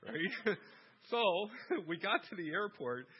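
chuckling through the story's punchline; laughing. Real, not acted. Father laughing as he tells of the rental upgrade, laughing.

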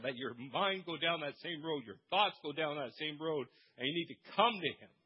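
ordinary talk

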